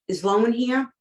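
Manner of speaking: frantic owner asking for the vet; urgent question. Retold or imitated. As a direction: rush the question out.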